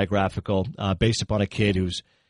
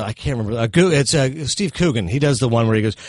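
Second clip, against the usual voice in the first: ordinary talk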